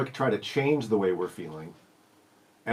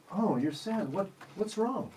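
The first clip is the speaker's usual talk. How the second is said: caring parent; 8 semitones higher, 8 dB quieter, slower than usual. Retold or imitated. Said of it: "you say".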